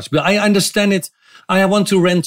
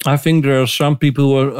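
ordinary talk, nothing marked

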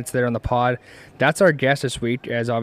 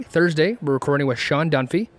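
ordinary talk

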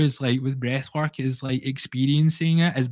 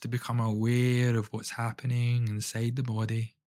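guided breathwork. Acted. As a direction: lead the listener gently through each breath. Leads a guided breathing session.

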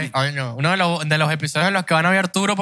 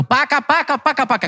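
high-pitched paca paca for heel clicks; clacking heels; 7 semitones higher than usual. Retold or imitated. Imitating heels on stairs. (imitando tacones, agudo)

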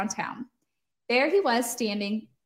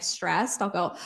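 normal talking voice

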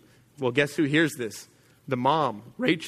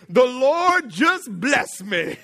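slow, high, put-on sweet voice; innocent liar; 9 semitones higher, 5 dB louder, much slower than usual. Son lying to his father, slow high innocent voice. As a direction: play it falsely pious.

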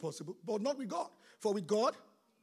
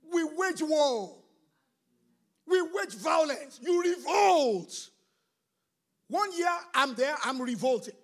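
louder, slow and drawn out, each word hammered; slow loud shout; 5 semitones higher, 8 dB louder, slower than usual. Real, not acted. Pastor rousing the church to spiritual war, slow shout. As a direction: roar it out like a war cry.

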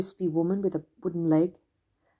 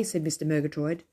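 ordinary delivery, nothing marked

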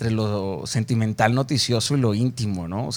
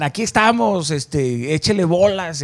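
a high, pushy cheer-on, louder and brighter; mock boss cheer; 6 semitones higher, 3 dB louder than usual. Bosses urging her to go for it, mock cheer.

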